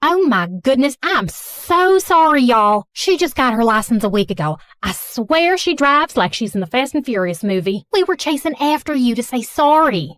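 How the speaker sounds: Southern mom, gushing apology; Southern accent. Acted. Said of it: "speaking in a thick Southern accent".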